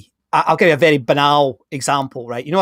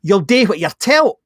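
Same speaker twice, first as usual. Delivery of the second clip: broad Glaswegian, slow and a bit louder; Glaswegian accent; 4 semitones higher, 4 dB louder than usual. Retold or imitated. Glaswegian accent, telling a worker what to do. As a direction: say it like a blunt Glasgow boss. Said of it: "I'll speak Glaswegian for a minute".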